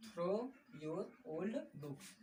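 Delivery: each word drawn out very slowly for the class; slow dictation. Shown as a demonstration. Teacher reading the finished sentence out very slowly. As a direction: spell it out slowly for students to copy. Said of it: "तो क्या हो गया".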